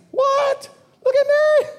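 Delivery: squealed, very high astonished cry; Peter amazed. Acted. Peter, walking on water, marvels to himself: squeaky astonishment. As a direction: gasp it out in disbelief and delight.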